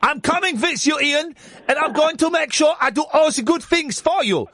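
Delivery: high-pitched comic German accent, imitating a woman; hammed-up German accent. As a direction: go over the top with a silly, high German voice. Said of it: "trying to do a German accent".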